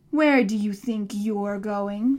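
slow, loud monster voice; demanding monster. A narrator's reading. Monster challenging the boy as he leaves, demanding. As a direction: demand it slowly and heavily. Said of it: "demanded the monster".

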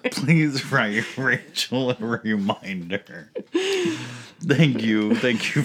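loud announcement. Pretend. Mock announcement continued.

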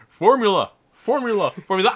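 high, loud goofy exclamation; playful high-pitched yelling; mock shouting. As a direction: belt it out as a silly catchphrase. Goofy outburst of repeated shouts.

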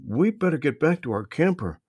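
put-on worried boy explaining they're lost; young boy voice. A narrator's reading. Brother explains to Marvin they lost the string, high boy voice. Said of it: "Trey said".

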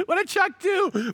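high-pitched mock concern, What did Chuck do; mock worried churchgoers. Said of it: "I'm sure that was their first conclusion".

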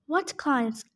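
usual speaking voice

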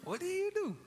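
high, piercing question to the crowd; rising challenge. Acted. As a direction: squeal it out to wake them up. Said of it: "preacher challenge".

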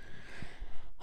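long, breathy sigh; weary sigh. Real, not acted. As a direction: sigh at the thought of work. Reluctant about work tomorrow.

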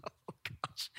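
soft breathy aside; whispered aside. Real, not acted. Preacher catching his breath after laughing, whispered. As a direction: breathe it to yourself.

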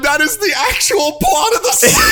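high, loud disbelieving shout; shocked shout. Real, not acted. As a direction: yell it in total disbelief. Shocked at guessed plot.

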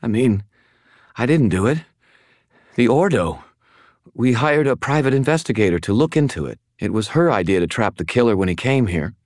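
high, flustered woman's voice; confused. Acted. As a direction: explain yourself, confused and defensive. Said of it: "now looking somewhat confused again".

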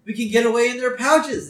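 put-on high, eager kid voice; lightly voiced Bart with a bright idea; mock-excited plan. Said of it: "he says".

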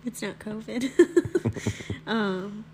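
congested, cold-stuffed voice; stuffy nose. Real, not acted. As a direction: talk through a head cold. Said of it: "if I sound like I have a cold".

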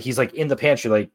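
ordinary talk

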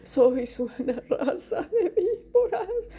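very high, slow mock sobbing; hammed-up tearful, tremulous squeak; trembling, sad voice. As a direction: quaver feebly, as if about to cry. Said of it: "con una voz trémula, triste".

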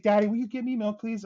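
high-pitched imitation of a polite child; asking nicely. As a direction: mimic a kid sweetly requesting a favor. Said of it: "if you ask nicely".

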